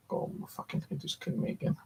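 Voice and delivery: whispered under the breath, in real pain; whispered grumble. Real, not acted. Complaining of headache under breath.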